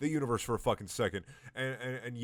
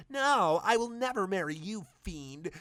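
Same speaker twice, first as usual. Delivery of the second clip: very high, loud refusal; much higher hammed-up heroine; defiant Oriana; 9 semitones higher, 4 dB louder than usual. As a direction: shriek a defiant no.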